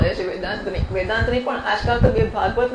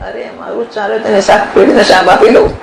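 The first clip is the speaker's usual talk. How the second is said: laughing mock retort; 7 dB louder than usual.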